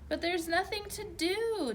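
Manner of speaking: high, whiny complaint; whining. A narrator's reading. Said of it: "Delee whined".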